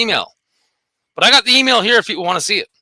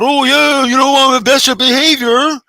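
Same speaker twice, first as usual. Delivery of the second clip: high, loud, slow put-on voice of a smug trainer; mocking impression; 5 semitones higher, 4 dB louder, slower than usual. Ranting vlogger mocks the rival trainer Garrett in a high, loud, slow sneering voice. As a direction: ham up the bragging rival, drawn out and nasal-proud.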